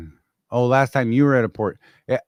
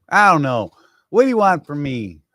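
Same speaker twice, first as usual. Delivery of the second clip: exasperated; 4 semitones higher, 7 dB louder than usual. Real, not acted. Exasperated outburst.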